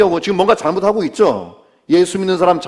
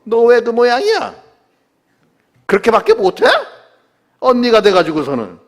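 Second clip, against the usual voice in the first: raised, high-pitched reproach; angry scolding; 7 semitones higher, 4 dB louder than usual. Acted. Preacher voices Jesus as if scolding Martha, angry reproach. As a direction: tell her off with exasperation, demanding better. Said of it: "막 화를 내시면서 야단치시거나 책망하는".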